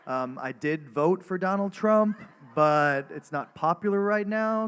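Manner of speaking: big American voice, every word very important; American accent. Shown as a demonstration. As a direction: put on a loud, self-important American accent. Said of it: "All right, American".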